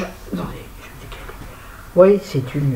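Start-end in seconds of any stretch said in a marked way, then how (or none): 0.0-1.3 whispered aside. Real, not acted. Aside while moving.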